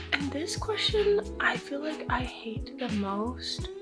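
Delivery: hushed dramatic whisper. Real, not acted. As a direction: drop to a whisper for effect.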